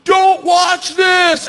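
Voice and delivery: high, loud, drawn-out yell; imitating her last yell before the jump; shouted warning. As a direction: bellow each word slowly over the wind.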